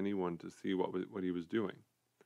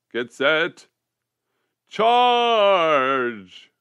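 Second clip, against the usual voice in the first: high, loud battle cry; shouted command; 8 semitones higher, 13 dB louder, much slower than usual. A narrator's reading. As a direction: yell the charge with full force. General orders charge.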